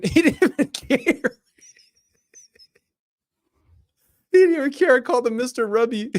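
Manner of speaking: high, loud incredulous laughing; laughing in disbelief. Real, not acted. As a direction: crack up, voice shooting way up in pitch. Cracking up at character's non-reaction.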